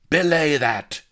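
loud, high, sharp order; snapped command. A narrator's reading. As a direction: cut the rabble off with a snap. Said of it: "snapped Sheba".

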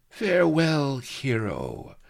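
high, loud opening falling away; high taunting send-off; mocking snarl. A narrator's reading. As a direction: jeer a scornful farewell after him. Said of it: "Sheba's smoky snarl followed him".

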